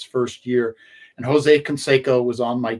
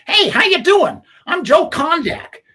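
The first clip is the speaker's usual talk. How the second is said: high, hammed-up East Coast accent; 5 dB louder than usual. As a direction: greet him like a brash East Coast guy. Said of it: "guy with an East Coast accent goes".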